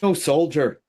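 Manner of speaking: put-on loud, high, musical Irish accent. Guy barging into a Roman scene, loud Irish accent. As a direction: burst in with a big lilting Irish accent. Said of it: "The fucking guy's Irish".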